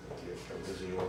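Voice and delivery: soft pretend demonstration; make-believe, hushed. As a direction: drop the voice to play pretend. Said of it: "Vamos fazer de conta". (faz de conta, em voz baixa)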